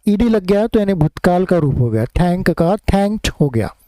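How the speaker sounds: ordinary talk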